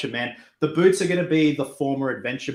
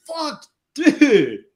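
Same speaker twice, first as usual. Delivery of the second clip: surprised; 14 semitones higher, 7 dB louder than usual. Real, not acted. Reacting to a super chat.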